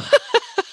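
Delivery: high bright burst of laughter. Real, not acted. Laugh after now I know.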